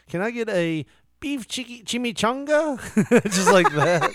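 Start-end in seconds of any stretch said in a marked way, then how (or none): 0.1-2.8 high, mock Spanish "chimichanga"; over-pronounced Spanish. Retelling sister-in-law's order, high overpronounced Spanish. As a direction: overdo "chimichanga" like a fake native. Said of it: "hearing my sister-in-law say".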